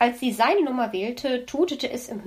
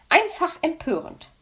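indignant; 7 semitones higher than usual. A narrator's reading. Indignant magpie repeats her outrage.

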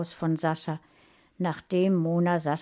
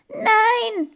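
put-on high, loud boy's scream of no; 9 dB louder than usual. A narrator's reading. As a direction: scream it out in horror. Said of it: "schrie Sascha". (hoher, lauter Schrei)

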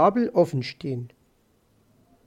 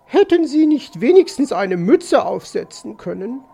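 higher, louder magpie voice; fussing magpie lady; 5 semitones higher, 5 dB louder than usual. Acted. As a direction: fuss over him as Mrs. Magpie. (besorgt tadelnde Elster)

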